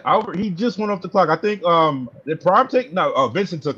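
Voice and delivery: yelling across the room. Real, not acted. Answering across the draft room.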